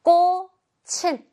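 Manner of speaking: 꼬, 칠 high, loud and widely separated; slow teacher's sounding-out of 꼬, 칠; syllable-by-syllable demonstration. Shown as a demonstration. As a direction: sound out 꼬, 칠 slowly, kko then chil. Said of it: "어떻게 읽어야 돼?".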